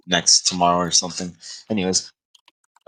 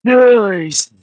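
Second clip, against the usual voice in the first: impressed; 13 semitones higher, 7 dB louder than usual. Real, not acted. Impressed by strength.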